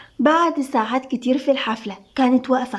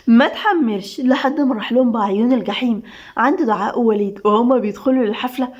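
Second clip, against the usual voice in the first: ordinary delivery, nothing marked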